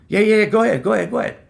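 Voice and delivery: high, loud brush-off; dismissive boss. Retold or imitated. Boss waving off worker's break request, high and dismissive. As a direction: rattle it off like a busy boss waving you away.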